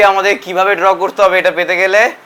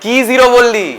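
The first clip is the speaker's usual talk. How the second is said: mock surprise; 5 semitones higher, 5 dB louder than usual. Teacher mock surprise at answer.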